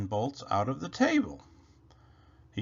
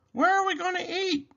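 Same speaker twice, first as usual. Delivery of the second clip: very high, wailing bunny question; Rabbit crying out; faster than usual. Acted. Rabbit yelling about where to eat, very high wail. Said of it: "he cried".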